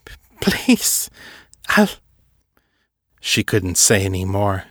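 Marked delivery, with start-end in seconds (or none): 0.0-1.9 high, whispered stammering plea; broken pleading. Acted. Seffi begging Fisher, stammering in terror. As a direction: stutter out the plea, breaking.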